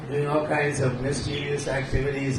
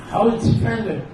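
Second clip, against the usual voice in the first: high, insistent question; a lightly voiced questioner; Vidyavali's voice; 8 semitones higher than usual. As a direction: press the serpent from up high. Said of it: "Krishna assumes these voices".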